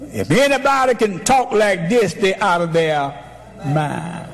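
"talk" पर चढ़ती पुकार; preaching. Acted. Preaching peak.

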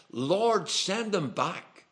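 high, slow, imploring cry to God; pleading prayer. Retold or imitated. Woman praying for the preacher's return, high slow pleading. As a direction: beg the Lord, drawing it out.

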